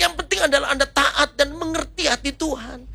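high-pitched, fervent preaching; preaching exhortation. Real, not acted. Preacher urges congregation to share the gospel, impassioned preaching.

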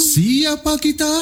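high, slow rallying call; yell call. Shown as a demonstration. Trainer showing a yel-yel to the audience, high slow call. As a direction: call out to the crowd like a cheerleader. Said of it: "yel-yel seperti ini".

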